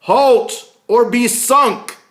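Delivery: very loud, high, slow bellowed command; Captain Smith shouting at the ship, commanding bellow. Acted. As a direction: bellow across the water. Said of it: "he shouts".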